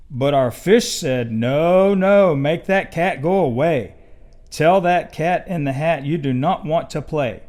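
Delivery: lively storybook read-aloud; reading to children. Acted. Papa Bear reading Dr. Seuss to kids, lively and animated. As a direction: read it like bedtime for little cubs.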